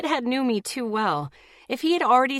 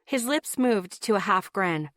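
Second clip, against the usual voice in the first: usual speaking voice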